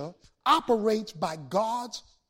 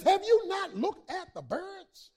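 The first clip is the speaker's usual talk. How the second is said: preaching high; 9 semitones higher than usual. Acted. Quoting Jesus, preaching.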